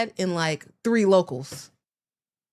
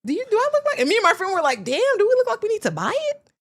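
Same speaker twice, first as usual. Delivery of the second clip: much higher, loud, indignant disbelief; incredulous mockery; 13 semitones higher, 4 dB louder than usual. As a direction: act offended at the pitch. Mocking the men's sales pitch.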